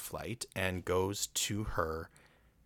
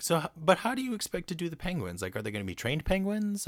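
baffled question; 10 semitones higher than usual. Retold or imitated. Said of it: "and she's like".

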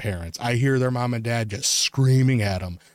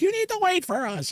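high mocking parent call; imitating a parent calling after kids. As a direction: nag like a harried mom.

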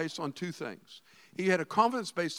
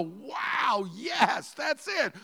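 mock delight; 7 semitones higher, much slower than usual. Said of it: "I'm just filled with the joy of the Lord".

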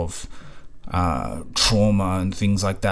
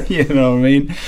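laughing; 6 semitones higher, 6 dB louder than usual. Real, not acted. Still laughing.

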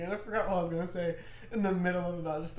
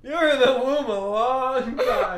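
louder, slow laughing repeat, cracking up; laughing tease; 5 semitones higher, 11 dB louder, slower than usual. Real, not acted.

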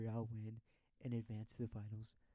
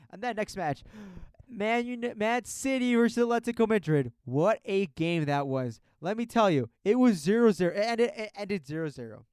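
excited; 8 semitones higher, 14 dB louder than usual. Real, not acted. Hyping up a big match.

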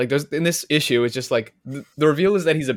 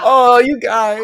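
teasing; 12 semitones higher, 11 dB louder, much slower than usual. Real, not acted. Teasing shared choice.

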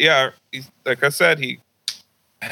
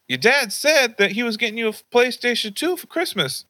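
higher, lightly voiced grandmother; grandma voice; 11 semitones higher than usual. Grandma tells grandson what dad promised, higher voice. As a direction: lift the pitch like a fussing grandma.

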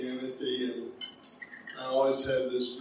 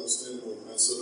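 normal talking voice

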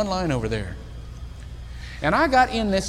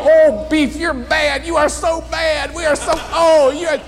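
much higher, loud, gushing admiration; gushing praise; 8 semitones higher, 6 dB louder than usual. Retold or imitated. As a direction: gush over him playfully. Steve teasing Beef admiringly.

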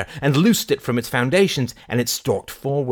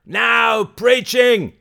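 high, loud, drawn-out heckle; shout; 12 semitones higher, 6 dB louder, much slower than usual. Acted. Man in the crowd heckling the preacher, slow shout. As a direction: shout it slowly across a crowd. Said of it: "shouted a man".

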